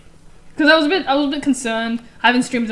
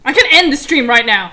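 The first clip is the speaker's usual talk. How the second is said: loud threat; 8 dB louder than usual. Real, not acted. Threatens friends to end stream.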